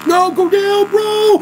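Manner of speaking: very high, loud shout; raging gamer. Real, not acted. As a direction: yell at the screen in despair. Yelling at the game.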